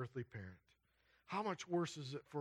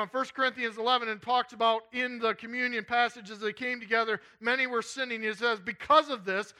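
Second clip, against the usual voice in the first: loud, high, urgent sermon delivery; full-force preaching; 7 semitones higher, 10 dB louder than usual. Real, not acted. Preacher warning the congregation God has had enough, full-force preaching. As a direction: drive the warning home at full volume.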